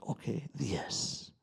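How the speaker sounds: slow calm guide. Retold or imitated. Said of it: "and then he's like".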